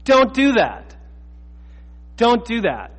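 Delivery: high, loud DON'T, falling through "do that"; emphatic warning. Real, not acted. Preacher warning congregation against embellishing gospel, emphatic "don't do that". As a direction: hit "don't" high and hard, then drop.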